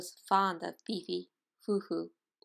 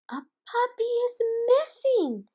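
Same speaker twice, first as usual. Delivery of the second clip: much higher, loud urgent cry; alarmed announcement; 9 dB louder than usual. Acted. Mother dog announcing to the pups, high loud alarm. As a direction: raise the alarm. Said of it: "Missus Bulldog announced".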